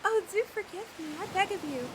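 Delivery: normal talking voice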